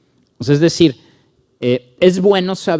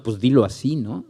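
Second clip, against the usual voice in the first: normal talking voice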